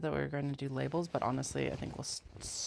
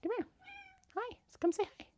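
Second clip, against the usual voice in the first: pet voice; 3 dB louder, faster than usual. Real, not acted. Coaxing cat.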